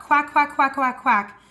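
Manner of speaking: put-on playful quacking for kids; duck quack. Shown as a demonstration. Swim coach shows kids duck bubbles, playful quack. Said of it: "blow bubbles like a duck".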